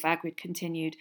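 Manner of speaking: usual speaking voice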